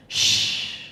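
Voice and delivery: short crisp unvoiced shush. Mother hushes the child, a crisp short shush. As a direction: cut the air with a sharp shh. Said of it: "It chops the air, crisp, sandy, short".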